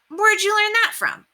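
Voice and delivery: put-on curious boy asking; Robert asking Murph where he learned it; boy's eager question.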